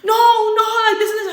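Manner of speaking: high, loud whiny refusal; hammed-up childish objection; mock dramatic protest. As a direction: imitate a boyfriend making a scene. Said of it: "he was being so dramatic".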